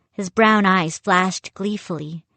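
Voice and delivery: ordinary delivery, nothing marked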